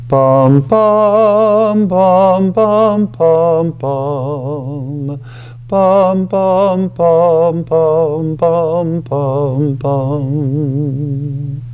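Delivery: sung bum bum imitating the organ intro; humming organ notes. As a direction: mimic the organ notes with your voice. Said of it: "And it goes".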